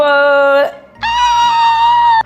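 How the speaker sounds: long, high, loud squealing cry; playful scream. Pretend. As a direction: let out a drawn-out playful shriek. Playful exclamation after a name.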